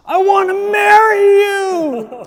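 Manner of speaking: high, loud, drawn-out yell out a window; screamed proposal. Retold or imitated. Brendan yelling to a random girl from the bus, loud high scream. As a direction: belt it out the window at a stranger. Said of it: "made himself obviously the weirdest, loudest person".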